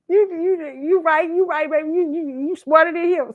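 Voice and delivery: put-on agree, tickled by the kid; tickled grandpa. Grandpa amused by granddaughter's logic, tickled. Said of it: "He said".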